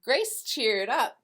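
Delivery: plain reading voice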